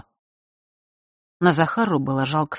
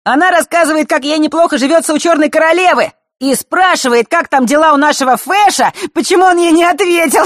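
high, loud teasing singsong; mocking girly voice; 13 semitones higher, 7 dB louder than usual. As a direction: mimic a silly girl. Felix mocks the letter aloud.

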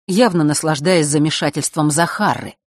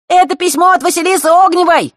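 high, loud gleeful shout; gleeful cry; 7 dB louder than usual. Acted. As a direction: announce it to everyone. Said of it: "вскричал Феликс".

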